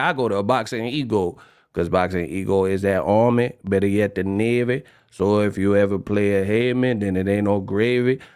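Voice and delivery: mock Louisiana accent, low and lazy; New Orleans flow. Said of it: "they be like".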